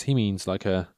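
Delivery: ordinary talk, nothing marked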